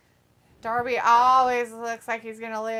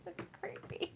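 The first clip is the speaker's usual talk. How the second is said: unvoiced creepy whisper; asmr whisper; 17 dB quieter than usual. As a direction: breathe it in a whisper. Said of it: "when we whisper".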